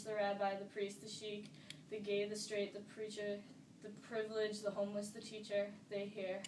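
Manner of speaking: even, measured recital in a steady pitch; poem recitation. Acted. Reciting a poem that means the world to them, measured recitation. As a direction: recite it from memory, phrase by phrase.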